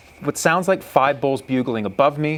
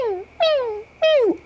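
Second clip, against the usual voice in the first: high-pitched elk mew; imitated cow mews. Hunter surrounded by cows, imitating their high mews. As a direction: squeal thin little mews. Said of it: "and I can hear mew".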